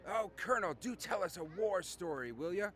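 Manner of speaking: put-on high, pleading young admirer; eager young guy. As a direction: beg the old colonel for a story in a lifted voice. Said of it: "one of the younger guys".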